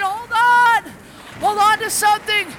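high, loud yell to hold on; panicked shout. Acted. Fishermen crying out in the storm, high panicked yell. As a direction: scream it over the waves. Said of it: "They're saying".

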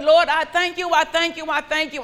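loud praise. Acted. Praise rising in worship.

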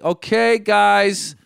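high, drawn-out, strained politeness; passive restraint. Retold or imitated. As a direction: hold back the annoyance, sound passive. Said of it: "it was coming out very kind of ... passive".

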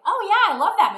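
bright burst of enthusiasm; excited. Retold or imitated. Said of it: "I'm like".

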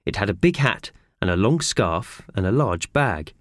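ordinary delivery, nothing marked

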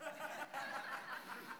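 high unvoiced laugh; airy laugh at own joke; breathless laugh. Real, not acted. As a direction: silent wheezing laugh.

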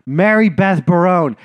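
finally remembering the actress's name, triumphant. Retold or imitated.

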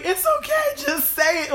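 very high, loud pleading mom impression; imitated insistent mom. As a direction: mimic a mom urging a confession. Retold mom pressing in argument.

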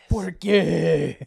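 hammed-up telenovela outburst; Antonio Banderas. Co-host joining the bit, loud melodramatic Spanish impression. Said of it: "like, Antonio Banderas".

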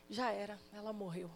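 very soft and low, numb; stunned grief. Acted. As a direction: barely voice it, in shock. Jairo repeats the news, stunned.

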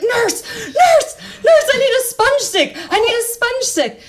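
very high, frantic mock scream; imitated screaming doctor. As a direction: yell for help as the panicking doctor. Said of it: "he's screaming".

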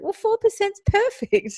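very high, drawn-out retold approval; delighted encouragement. Mother to daughter who is slightly nervous, delighted encouragement. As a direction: sing out approval slowly in a high voice. Mother cheering on child's small nerves.

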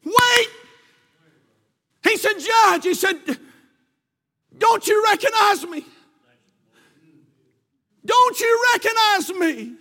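high, loud frantic cry; desperate pleading. Retold or imitated. Condemned young man pleading with the judge, high and loud. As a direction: beg the judge in panic. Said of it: "he began to scream".

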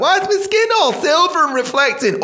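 high-pitched mock panic; mock alarm. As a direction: wail it in comic disbelief. Sunscreen user alarmed at own skin.

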